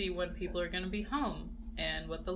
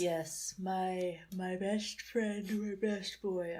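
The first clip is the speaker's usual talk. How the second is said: talking through a yawn; stretched, yawning words; slower than usual. Real, not acted. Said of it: "I'm sorry, I'm yawning".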